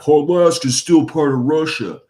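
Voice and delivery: lightly voiced blowhard making a claim; mock dumbass pundit. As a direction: ham up a bombastic talking head. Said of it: "some dumbass is like".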